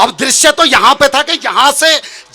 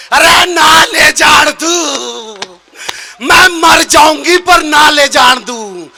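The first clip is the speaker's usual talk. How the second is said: pleading aunt; 3 semitones higher, much slower than usual. Acted. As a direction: beg with long drawn-out word endings. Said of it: "पैरों में गिर के बोली". (गिड़गिड़ाती बुआ का ऊँचा विलाप)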